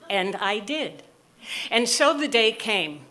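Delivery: usual speaking voice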